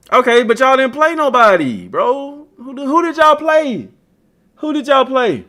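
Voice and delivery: high, loud teasing challenge; taunting, pitched high; mocking taunt. Real, not acted. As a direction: needle the fans about their schedule. Taunting Notre Dame fans.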